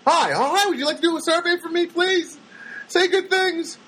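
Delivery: high, fast, sugary begging voice; mocking clerk's plea. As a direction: parody an eager clerk fishing for praise.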